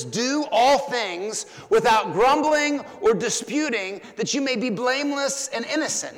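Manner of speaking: high, loud verse reading from the pulpit; forceful scripture declamation. Real, not acted. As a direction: proclaim the verse to the congregation.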